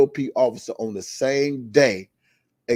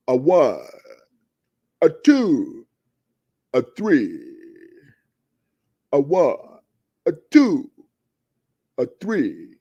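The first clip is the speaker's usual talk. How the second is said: loud, pausing count like the Sesame Street counting vampire; 2 semitones higher, 6 dB louder, slower than usual. Retold or imitated. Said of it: "you remember dude like".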